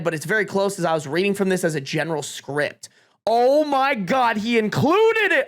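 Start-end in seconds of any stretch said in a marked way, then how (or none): 3.3-5.4 high, slow, drawn-out delight; thrilled surprise. Real, not acted. Streamer discovering the speech is included, thrilled. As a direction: stretch it out in excited disbelief.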